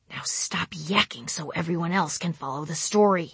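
hissed whisper. Retold or imitated. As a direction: whisper it in annoyance. Frustrated inner aside.

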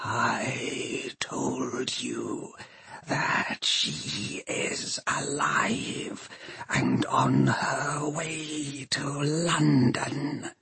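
slow, whispery undead machine; horrible voice. A narrator's reading. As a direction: whisper it haltingly, word by word. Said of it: "It is a horrible voice".

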